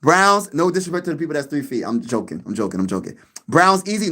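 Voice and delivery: ordinary talk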